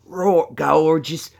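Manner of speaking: loud Australian croc-hunter voice; hammed-up wildlife host; Steve Irwin. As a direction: punch it out in broad Aussie, excited. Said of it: "that's Steve Irwin".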